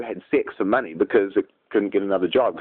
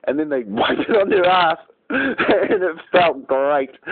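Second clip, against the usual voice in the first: laughing while telling; 6 semitones higher, slower than usual. Real, not acted. Laughing through story.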